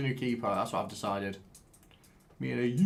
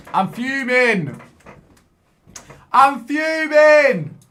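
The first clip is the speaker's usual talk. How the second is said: slow, drawn-out yell, much higher and louder; fuming yell; 11 semitones higher, 13 dB louder, much slower than usual. Real, not acted. Gamer losing to the screen, long furious yell. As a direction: stretch out the fury and bellow it.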